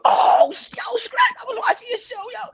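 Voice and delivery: high, loud starstruck fan voice. Retold or imitated. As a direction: burst out recognizing a celebrity. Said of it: "He goes".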